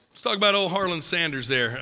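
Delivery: ordinary talk, nothing marked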